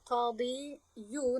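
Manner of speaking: قاضی یو with an added yu ending, marked as wrong; wrong form shown. Shown as a demonstration. Teacher showing students the form قاضی یو they must not say. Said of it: "نمیگویم".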